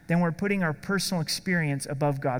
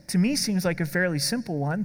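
usual speaking voice

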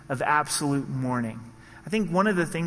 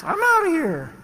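high, loud exasperated whine breaking into a laugh; frustrated quitting; 12 semitones higher, 5 dB louder than usual. Retold or imitated. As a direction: throw up your hands and quit, squeaky and loud. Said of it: "but it's like".

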